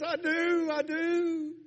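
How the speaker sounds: high, loud, emphatic joy; overjoyed. Retold or imitated. New believer affirms Jesus in her heart, overjoyed. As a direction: imitate a thrilled new believer.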